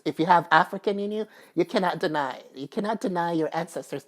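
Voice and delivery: ordinary talk, nothing marked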